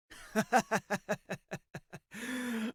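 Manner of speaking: high, rolling villain cackle; villain's laugh. Acted. Skullman reading the headlines, mocking villain laugh. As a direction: laugh long and high in mockery.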